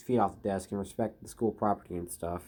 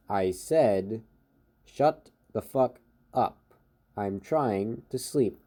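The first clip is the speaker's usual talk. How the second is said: slow, word-by-word repetition of the demand; very slow repeat; slower than usual. Acted. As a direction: repeat it slowly, spacing out each word. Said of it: "he repeats it very slowly".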